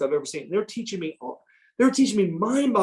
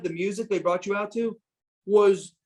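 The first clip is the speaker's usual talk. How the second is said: normal talking voice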